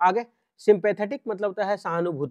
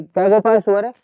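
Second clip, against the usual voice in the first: loud, higher call asking how many passed; calling out a question; 5 semitones higher, 13 dB louder than usual. Acted. Student shouting to ask how many passed, loud call. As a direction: shout the question across to the teacher.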